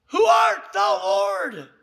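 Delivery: high, loud question, falling on the last word; loud cry. Retold or imitated. Saul in the dirt questioning the voice, loud cry. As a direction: cry out to the unseen voice.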